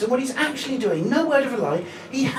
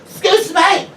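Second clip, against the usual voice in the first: high, louder insistent calling; drunk woman; 10 semitones higher, 6 dB louder, slower than usual. Retold or imitated. Drunk woman calling to the shop worker, high and pushy.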